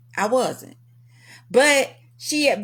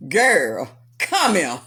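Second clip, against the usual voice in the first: low, firm summons from the manager; a low, no-nonsense summons; stern boss voice; 5 semitones lower, slower than usual. Retold or imitated. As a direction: call her over like a stern boss.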